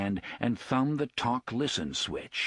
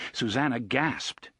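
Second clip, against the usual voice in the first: usual speaking voice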